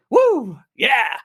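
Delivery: high-pitched crowd whoop; imitated whooping. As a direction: whoop like an American audience. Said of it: "the number of whoops I heard".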